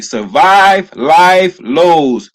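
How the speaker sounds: drawn-out heading read; slow, weighty title read; slow emphatic reading. Shown as a demonstration. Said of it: "repeats heading slower".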